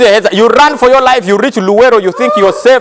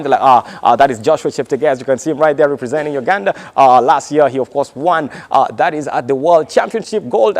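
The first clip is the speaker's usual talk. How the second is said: race commentator; 4 semitones lower than usual. Acted. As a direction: murmur it like a broadcast commentator over the start line.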